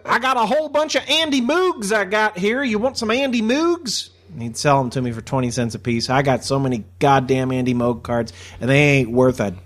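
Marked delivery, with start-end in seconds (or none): 0.1-4.1 high-pitched mock dealer pitch; high imitation of a shop guy; card store guy. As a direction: imitate a card seller offering his stash, pitched way up. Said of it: "card store guy we used to go to".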